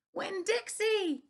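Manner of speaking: high, loud, drawn-out scream of the name. A narrator's reading. Girl screaming for her lost dog in the rain. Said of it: "I screamed".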